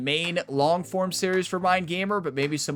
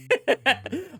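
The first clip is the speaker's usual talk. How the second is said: real laugh; 9 semitones higher than usual. Real, not acted. Laughs at interceptions joke.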